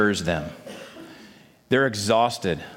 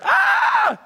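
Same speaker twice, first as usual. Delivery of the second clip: much higher, panicked scream of ghost-fright; screaming in terror; 3 dB louder than usual. Acted. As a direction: shriek like terrified disciples seeing a ghost. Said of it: "screaming in terror".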